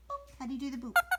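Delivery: ordinary talk, nothing marked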